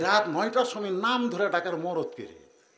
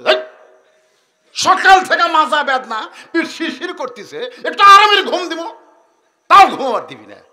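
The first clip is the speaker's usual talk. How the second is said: nagging wife; 10 semitones higher, 16 dB louder than usual. Acted. Wife scolding husband shrilly.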